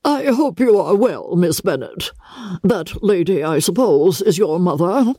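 cool, condescending politeness to the host's daughter; very stiffly. A narrator's reading. Grand lady sizing up the daughter, stiffly polite. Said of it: "she said very stiffly".

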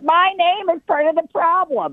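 high-pitched mock distress; imitated mad mom. Mom upset that her name is Karen, hammed-up outrage. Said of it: "She's so mad".

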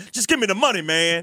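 high, pushy stick-up demand; crook voice. Retold or imitated. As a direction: play a mugger demanding cash. Playing the crook.

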